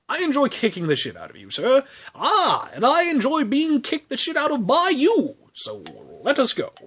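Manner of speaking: high, hammed-up polite British accent; mock British accent. Two fighters thanking each other on set, mock British accent. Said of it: "And I went with British".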